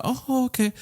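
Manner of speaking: high, soft "oh, okay"; mom's voice. Retold or imitated. Mom accepting her son's explanation, lightly voiced high mom. Mom's reply in retold story.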